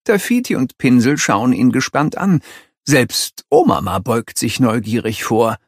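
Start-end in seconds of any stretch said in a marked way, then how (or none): none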